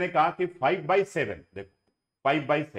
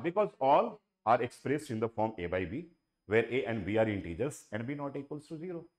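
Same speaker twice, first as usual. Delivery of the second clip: slow dictation; 4 semitones lower, 4 dB quieter, much slower than usual. Shown as a demonstration. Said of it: "दो तीन minute बोल सकते हो".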